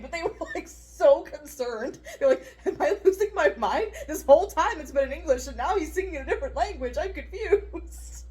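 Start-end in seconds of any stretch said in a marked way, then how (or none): 2.7-8.2 higher, louder, laughing through it; mock confused client. Yoga client asking if she is losing her mind, high mock panic. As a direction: play the baffled client for laughs.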